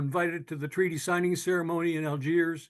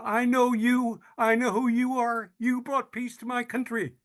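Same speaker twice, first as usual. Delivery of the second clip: much higher and louder, thrilled recognition; retold excited vendor; 7 semitones higher, 3 dB louder than usual. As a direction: retell her delighted surprise on meeting him. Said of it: "And her eyes got big".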